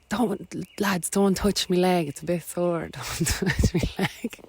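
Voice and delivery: high, half-laughing plea; pleading. Retold or imitated. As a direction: beg them not to touch it. Injured, begging lads not to touch.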